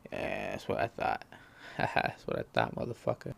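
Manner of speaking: whispered and smug, with a laugh; gloating whisper. Acted. Smug victor gloating under his breath, whispered. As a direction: hiss the last word of the standoff in a smug whisper. Gloating after winning the standoff.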